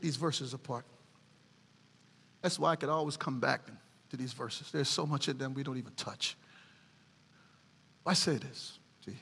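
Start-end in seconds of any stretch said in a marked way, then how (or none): none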